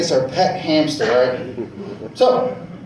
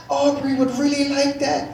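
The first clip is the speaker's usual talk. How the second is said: put-on little girl; 6 semitones higher than usual. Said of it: "She's like".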